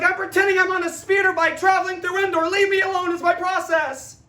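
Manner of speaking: high, fast, dreamy voice, brushing them off; a guy lost in his pretend speeder bike ride; spaced-out daydreamer. Seth Green's hero, insisting on his process in a high dreamy voice. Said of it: "He's like".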